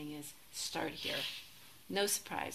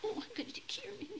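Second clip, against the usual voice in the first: tiny, very high, whispery cutesy voice; hammed-up cooing self-pampering, quick and squeaky high; mock preciousness; 3 dB quieter, faster than usual. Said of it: "not preciousness".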